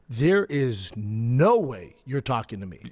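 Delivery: deliberate, incredulous put-down; retold stern warning. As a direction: warn a youngster, word by word. Retold stern reply to kid.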